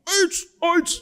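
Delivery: high, sharp karate yells; karate kiai. Retold or imitated. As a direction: shout like a karate master drilling. Karate shouts demo.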